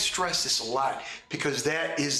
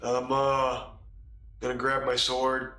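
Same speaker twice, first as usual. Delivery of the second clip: lazy, listless mumble; half-hearted bored player voice; bored and flat; 3 semitones lower than usual. Retold or imitated. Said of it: "Most people are like".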